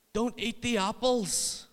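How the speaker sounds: high, slow, drawn-out holler; shouting across room. Retold or imitated. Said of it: "he shouts over this room".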